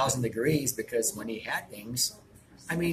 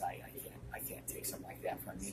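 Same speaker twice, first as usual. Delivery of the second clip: softer, lower, almost whispered; hushed refusal; 4 semitones lower, 14 dB quieter than usual. Retold or imitated. To Elvis, humbly refusing his cross in a hush. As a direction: protest gently, under the breath.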